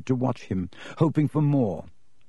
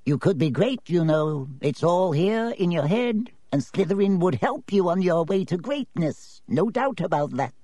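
small voice; 6 semitones higher than usual. Acted. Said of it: "the small voice".

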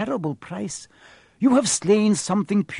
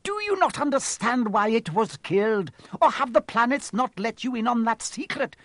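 defiant anger; 10 semitones higher than usual. Acted. Centaur defies rival.

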